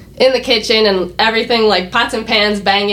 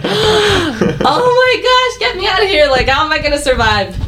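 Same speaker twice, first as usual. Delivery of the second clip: overwhelmed intern pleading to escape, mock panic; 10 semitones higher than usual. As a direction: whimper in mock despair, much higher. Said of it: "And I was just like".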